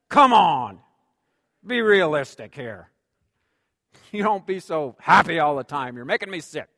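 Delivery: loud, exasperated mock complaint; mock grumble. Preacher mocking a grumpy churchgoer, loud exasperated grumble. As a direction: playfully gripe at the always-happy.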